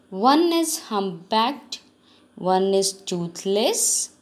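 slow, careful reading to pupils; measured classroom story narration; story read-aloud. A narrator's reading. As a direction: read it out slowly, phrase by phrase.

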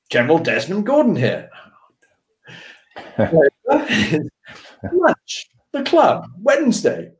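high-pitched mock officer issuing a summons; imitated general on phone. Imitates general's second phone call.

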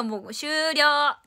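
loud, drawn-out announcement; calling game over. Real, not acted. Player declaring the game finished, loud drawn-out shout. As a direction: call time loudly, stretching the word.

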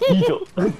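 loud, high surprised disbelief. Real, not acted. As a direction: blurt it out in shock. Surprised no sibling.

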